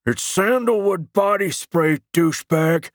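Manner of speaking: slow, high-pitched guttural holler; drawn-out, strained monster-like shout. A narrator's reading. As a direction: holler it like a hulking brute. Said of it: "deep, guttural voice shouted".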